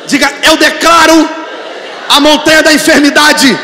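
loud, high declarations, slow and hammered; preaching at full force. Acted. Pastor leading the church in declaring against fear and illness, shouting. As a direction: command each mountain to leave, shouting.

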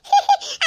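high burst of happy laughter; pleased laugh. Acted. Mother-in-law laughs happily over her birthday plan. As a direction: laugh with delight. Delighted laugh after explaining.

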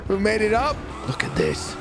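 usual speaking voice

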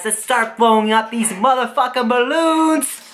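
rally the viewers with a high burst; hyped up. Real, not acted. Hyped start of the balloon task.

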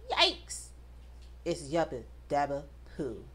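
usual speaking voice

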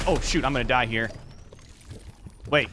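normal talking voice

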